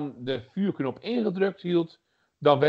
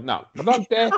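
higher, indignant mock gratitude; sarcastic thanks; 7 semitones higher, 4 dB louder than usual. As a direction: thank them as if cheated. Mock thanks for feeble game ending.

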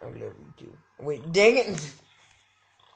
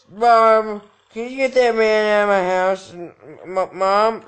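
louder, higher call to mom; mock panicked shout; 4 semitones higher, 9 dB louder than usual. Calling mom about intruder.

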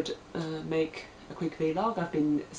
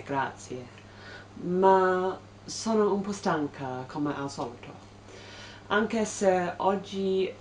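learner's accented Italian; 4 semitones higher than usual. Real, not acted. Vlogger greeting viewers in newly learned Italian, halting learner's accent. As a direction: speak careful, halting Italian with a foreign accent. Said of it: "please excuse my terrible, um, accent and pronunciation and fluency".

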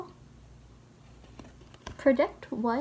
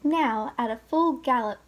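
usual speaking voice

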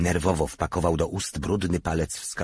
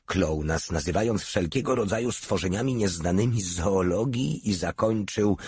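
plain reading voice

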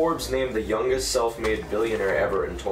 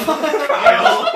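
very high laughing Kyle; high laughing echo; 11 dB louder than usual. Real, not acted. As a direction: laugh out the name. Mocking wrong answer.

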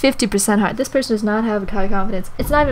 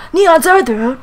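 loud, high exclamation; hammed-up shouted name; dramatic call; 5 semitones higher, 6 dB louder than usual. Reading out nonsense name.

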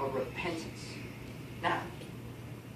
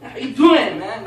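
hearty greeting; 3 semitones higher, 9 dB louder than usual. Shown as a demonstration. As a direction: hail a buddy loudly. Demonstrates hearty greeting to Eddie.

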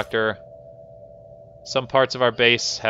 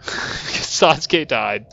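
amused laughing; much slower than usual. Real, not acted. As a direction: laugh breaking in before the words. Laughs at the character's death.

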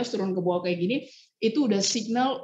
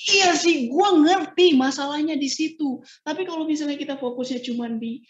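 match their raised voice without fighting; 6 semitones higher, 4 dB louder than usual. Shown as a demonstration. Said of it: "kamu juga tinggiin". (menyamakan nada tinggi lawan bicara tanpa bertengkar)

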